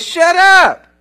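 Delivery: sharp loud rebuke, high; a booming shut up; commanding shout. Acted. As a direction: shout it down like the Lord silencing Satan. Lord rebukes Satan in sermon.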